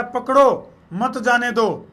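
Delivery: पकड़ो set apart, then मत जाने दो together; pause after पकड़ो. Shown as a demonstration. Host showing viewers how meaning can change, pause after पकड़ो. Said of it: "इसके दो मतलब ध्यान तो सुनियो".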